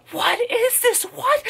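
very high falsetto amazed squeal; mock amazement. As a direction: overplay the wonder.

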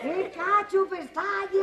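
high, slow mock cry; hammed-up wail over an eyelash; exaggerated exclamation.

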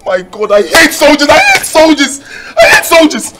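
high, loud anguished ranting; hysterical lament. Acted. Forced groom raging that her father was a soldier, hysterical wailing.

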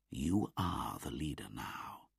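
whispered, soft words; softly. Acted. Bagheera quietly advising Mowgli, whispered. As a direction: breathe it to Mowgli. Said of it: "said Bagheera softly".

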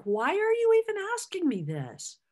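imitating an exasperated Peter, pitched high. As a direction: play it as a hurt, disbelieving friend. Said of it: "I can imagine he was kinda like".

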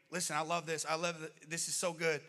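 normal talking voice

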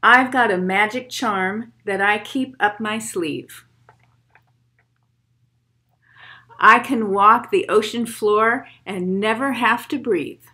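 put-on steady rhythmic poem read for kids. Reads poem aloud to children.

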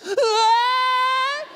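high, loud imitated baby cry; loud fake baby wail; mock baby wail. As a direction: wail like a hungry toddler. Baby crying in high chair.

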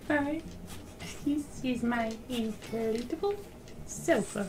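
tiny high-pitched cutesy voice; cutesy squeak. Pretend. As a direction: say it high and small, playfully. Poses in game with sofa.

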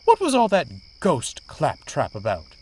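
exasperated, emphatic query; huffy demand. A narrator's reading. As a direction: demand it with haughty impatience. Daisy demands explanation, still huffy.

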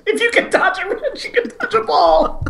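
high-pitched laughing quote. Retold or imitated. Friend laughs the dodgeball quote back at Cam, high and giggling.

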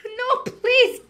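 high, crying plea; panicked cry. Real, not acted. Gamer losing a round, crying out in panic. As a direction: beg the game in a panic.

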